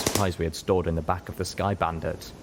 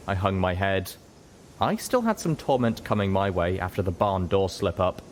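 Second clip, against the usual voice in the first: plain reading voice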